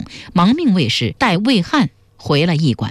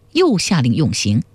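ordinary delivery, nothing marked

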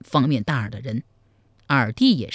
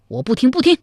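high, loud, agitated protest; stubborn refusal; 9 semitones higher, 4 dB louder, faster than usual. A narrator's reading. Zhao Kuangyin loudly refuses to hear his brother's plan. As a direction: cut him off, refusing to listen.

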